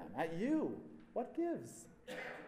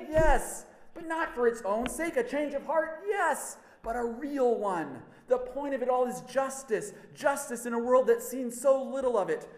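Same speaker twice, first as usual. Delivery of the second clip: higher, louder, impassioned exhortation; fiery preaching; 6 semitones higher, 5 dB louder than usual. Acted. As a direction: rouse the crowd to justice. John preaches to the crowd.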